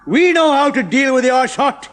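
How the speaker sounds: loud, slow menacing growl. A narrator's reading. Chemist growling at Ronnie, loud and slow. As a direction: threaten them. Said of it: "he growled".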